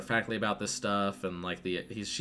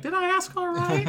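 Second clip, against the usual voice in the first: very high, louder put-on voice; high mock voice; 7 dB louder than usual. Missus Walker asking the neighbor, in a squeaky high mock voice. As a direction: squeak out the question.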